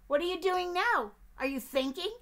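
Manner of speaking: high, loud, pushy little duck; eager duck. Acted. Duck pestering the bear with questions, high and loud. Duck's voice.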